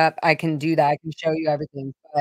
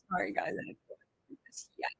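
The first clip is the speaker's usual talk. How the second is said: choked up; 17 dB quieter, faster than usual. Real, not acted. Breaks off while telling painful story.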